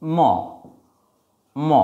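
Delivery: ম said as a bare m sound; teacher modelling ম. Shown as a demonstration. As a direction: hum out ম twice like a phonics drill. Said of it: "is pronounced as".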